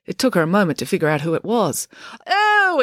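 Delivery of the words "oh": very high, loud exclamation; exclaiming. A narrator's reading. April recognising her dad, exclaiming in surprise. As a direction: cry out in sudden realisation. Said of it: "exclaimed April".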